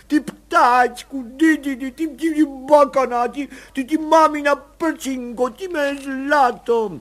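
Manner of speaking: high, slow weeping coos; tearful baby talk. A narrator's reading. Mother weeping over her baby, high slow tearful coos. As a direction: sob and coo over the baby. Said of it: "křičela v pláči".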